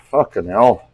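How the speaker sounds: ordinary talk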